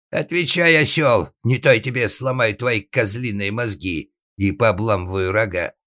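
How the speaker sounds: loud, high burst easing into menace; furious threat. Acted. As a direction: threaten the boy as an enraged father. Furious father threatens his son.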